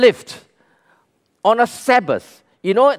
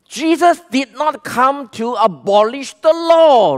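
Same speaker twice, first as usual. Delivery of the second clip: normal talking voice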